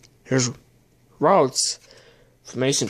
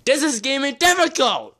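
much higher, strained complaint; exasperated at the struggle; frustrated; 10 semitones higher than usual. Real, not acted. Phone acting up during the pack opening, exasperated. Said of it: "So I'm swearing because it's difficult".

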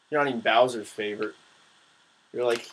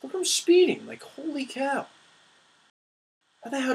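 excited; 12 semitones higher than usual. Real, not acted. Speeding in race.

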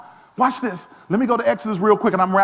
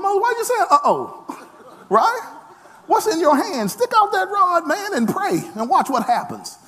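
higher, amused, teasing God voice; God's voice, playful; 9 semitones higher than usual. Retold or imitated. God teases a worried Moses, high amused voice. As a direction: let God chuckle at Moses' worry. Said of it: "God said to Moses".

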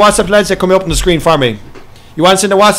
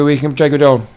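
ordinary talk, nothing marked

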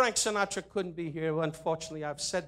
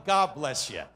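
normal talking voice

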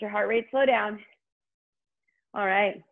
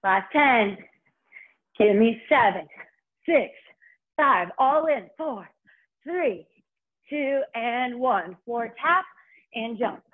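aerobics instructor; 2 semitones higher, slower than usual. Acted. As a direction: call out each number to push. Instructor counting down loudly.

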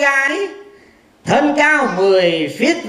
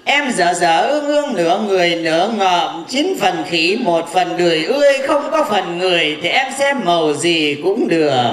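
lower mock insult; 6 semitones lower than usual. Thầy bói chê khách.

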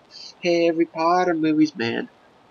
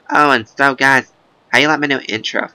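normal talking voice

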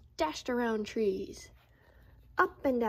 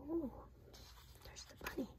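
soft whisper; whispered discovery; 5 semitones higher, 15 dB quieter, faster than usual. Real, not acted. Reader spots the bunny, whispered. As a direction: point it out in a hush.

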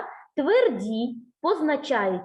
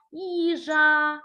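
Їжа with both vowels drawn out; slow, sounded-out Їжа; stretched word; 3 semitones higher than usual. Shown as a demonstration. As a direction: lengthen Їжа so the final А is heard. Teacher gives word to hunt А.

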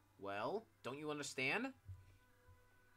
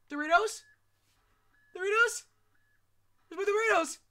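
high loud whiny squeal; whiny demand; 14 dB louder than usual. Pretend. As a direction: whine for the snack like a spoiled kid. Demanding missing Doritos.

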